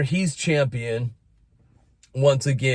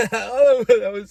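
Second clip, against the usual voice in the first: laughing; 10 semitones higher, 5 dB louder, slower than usual. Real, not acted. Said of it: "I'm not crying".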